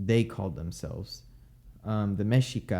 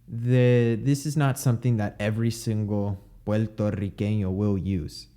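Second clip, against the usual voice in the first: ordinary talk, nothing marked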